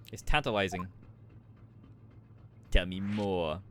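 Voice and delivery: usual speaking voice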